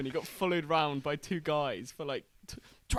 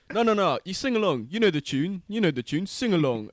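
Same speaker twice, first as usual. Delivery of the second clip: loud, higher pushy fan; pushy football fan; 4 semitones higher, 8 dB louder than usual. Retold or imitated. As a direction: urge them loudly to sing. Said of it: "And he goes".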